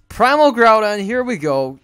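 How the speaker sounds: loud, high hype call; shouting the card name; excited shout. Real, not acted. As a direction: announce it like a champion. Hyping his card.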